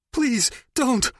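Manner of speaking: high, shaking begging; quivering plea. Acted. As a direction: plead through tears. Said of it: "His voice quivered".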